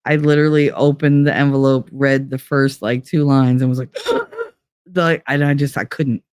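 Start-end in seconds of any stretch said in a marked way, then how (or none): 4.0-4.4 sudden high gasp of shock; a quick alarmed gasp; retold sharp high gasp. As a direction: gasp as if struck by panic. Reliving the panic.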